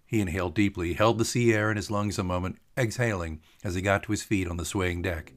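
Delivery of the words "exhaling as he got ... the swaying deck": ordinary delivery, nothing marked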